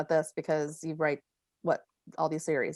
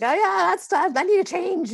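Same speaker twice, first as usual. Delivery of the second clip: high, loud airheaded chirp; mock a new mum too foggy to object; baby mush brain; 12 semitones higher, 7 dB louder than usual. To her hairdresser, a high, loud, dazed and eager yes. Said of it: "baby mush brain".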